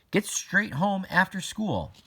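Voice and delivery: a mom's curt order, lifted; stern mom. A narrator's reading. Mom texting Iris, lightly voiced stern order.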